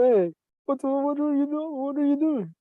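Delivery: high, loud call into the dark tent. Retold or imitated. Retold yelling at his buddy crushing the tent. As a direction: yell at the buddy to find out what's going on.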